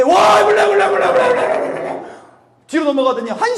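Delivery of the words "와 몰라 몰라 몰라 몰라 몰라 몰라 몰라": high, loud babbling; mock tongues babble. Imitating tongues outburst. (방언하듯 높고 크게 흉내)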